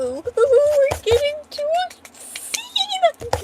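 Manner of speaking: high, slow excited squeal at reaching a scene. Real, not acted. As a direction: squeal it out, thrilled the scene is next. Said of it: "So I'm getting really excited".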